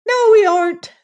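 squeaky creature denial; dwarfs. Acted. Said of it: "they said".

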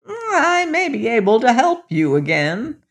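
put-on high old hag voice. A narrator's reading. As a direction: croak it like a kindly hag. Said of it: "said the old hag".

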